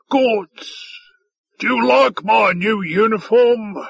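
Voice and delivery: high, booming, self-pleased question; blustering character voice; boisterous alien officer. A narrator's reading. Boisterous alien officer asks his guest.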